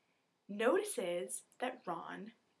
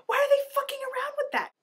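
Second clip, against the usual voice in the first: much higher and louder, fed up; exasperated outburst; 11 dB louder than usual. Real, not acted. Ranting to viewers about wizards hoarding cures, exasperated shout. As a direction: throw the question out in disbelief.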